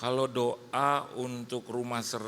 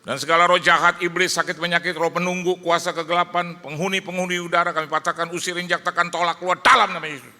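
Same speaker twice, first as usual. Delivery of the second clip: high, loud commanding deliverance prayer; 7 semitones higher, 10 dB louder than usual. Retold or imitated. Pastor praying loudly over the haunted house, commanding. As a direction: drive the spirits out at full voice. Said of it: "Saya doa kenceng".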